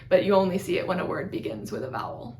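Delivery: normal talking voice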